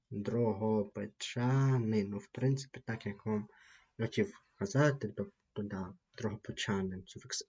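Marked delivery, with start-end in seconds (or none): none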